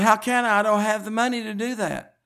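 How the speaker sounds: high, loud pleading cry of disbelief; desperate protest. Retold or imitated. Woman protesting to her mother about money, high and loud. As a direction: plead like it's impossible.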